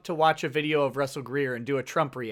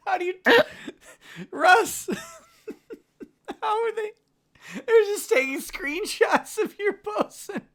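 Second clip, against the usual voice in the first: high, helpless laughing speech; laughing while talking. Real, not acted. Co-host cracking up over twisted posts, laughing hard. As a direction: squeeze the words out through laughter.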